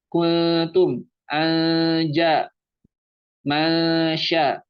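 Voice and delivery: "Kuntum", "Anja", "Masya" with long hidden N; plain blurred ikhfa. Shown as a demonstration. Teacher listing nakis examples, "Anja" with held blurred N. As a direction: chant each on one note, blurring the N. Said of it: "samar aja biasa".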